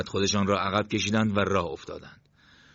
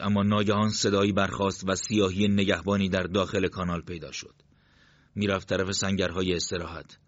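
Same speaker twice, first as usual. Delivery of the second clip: plain reading voice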